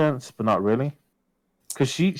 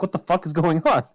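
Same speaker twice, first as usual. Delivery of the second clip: higher-pitched, incredulous retold demand; retold alarm; 8 semitones higher than usual. Reacting to a crying girlfriend's call, retold alarm. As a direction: act out being alarmed on the call.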